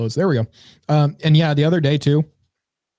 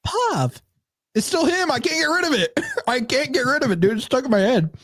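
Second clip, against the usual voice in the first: high, lilting TikTok voice; whimsical TikTok guy voice; 9 semitones higher than usual. Retold or imitated. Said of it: "I'm still stuck on the guy".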